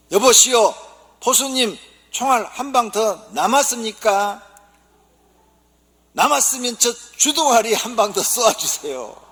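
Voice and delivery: mock eager husband. Sparrow husband begging the hunter, hammed-up high squeak.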